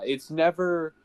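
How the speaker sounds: normal talking voice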